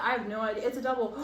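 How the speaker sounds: ordinary talk, nothing marked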